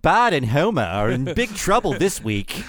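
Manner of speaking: mock British promo read; hammed-up British TV announcer. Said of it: "I like when it's like".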